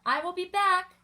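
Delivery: high, loud call across the field. Acted. Librarian calls to the children as he leaves, loud and high. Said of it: "he calls to the children".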